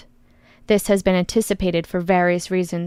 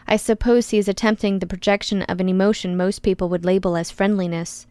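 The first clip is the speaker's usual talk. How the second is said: usual speaking voice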